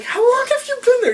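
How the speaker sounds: playing young Bruce as a stubborn little kid. Young Bruce brushing off Alfred, squeaky and high. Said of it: "And Bruce's like".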